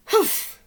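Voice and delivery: high, loud huffy grunt; huff. Acted. McGreely scoffing at the bunnies, a loud huff. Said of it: "he huffed".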